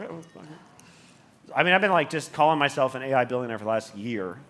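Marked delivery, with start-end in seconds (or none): 0.0-1.5 slow, hushed, breathless aside; slow conspiratorial whisper. Retold or imitated. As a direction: whisper it like giving in. Retold resigned reply to challenge.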